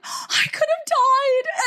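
high, slow horrified wail; dragged out in mock terror. Retelling her fright after the cabin, high slow wail. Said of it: "I was like".

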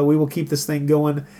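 ordinary delivery

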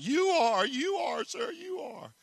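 high, fading frightened whimper; trembling terror. Acted. Trembling animal answers the roaring lion, terrified whimper. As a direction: cower and squeak it out, near tears. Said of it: "the elephant just trembles".

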